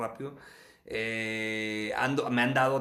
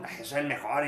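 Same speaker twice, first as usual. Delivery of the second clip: mock boastful thought. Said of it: "las ideas son".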